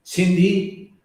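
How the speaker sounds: ordinary delivery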